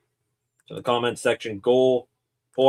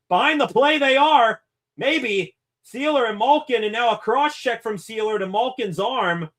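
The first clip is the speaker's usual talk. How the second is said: high hockey call, rising as Sealer and Malkin fight behind the net; live play-by-play; 7 semitones higher than usual. Acted. Streamer calling the Sealer and Malkin scrum, building play-by-play.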